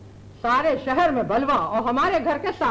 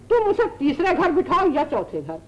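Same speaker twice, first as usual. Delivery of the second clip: ordinary delivery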